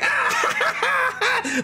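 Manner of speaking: laughing shriek. Acted. GM voices mocking satyrs.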